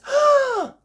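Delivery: loud, high intake of breath; big astonished gasp; shocked gasp. A narrator's reading. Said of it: "gasped Miss Rabbit".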